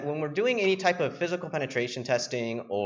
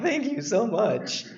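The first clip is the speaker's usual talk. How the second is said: higher, slow, gushing thanks; grateful intern; 5 semitones higher, slower than usual. Retold or imitated. Intern thanking the executive, higher, slow gushing voice. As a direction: play it wide-eyed and grateful. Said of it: "I'm like".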